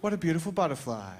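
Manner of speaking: lightly hammed-up gentle awe; mock wonder. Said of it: "you're like".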